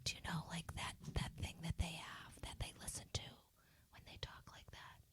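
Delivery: very soft, voiceless whisper. Shown as a demonstration. Asking friends to name this talk, whisper. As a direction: whisper it hushed and close. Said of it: "in a whisper".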